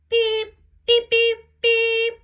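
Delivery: high, loud engine whistle beeps. A narrator's reading. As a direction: toot like a train whistle. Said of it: "whistled Thomas".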